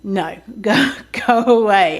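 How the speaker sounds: normal talking voice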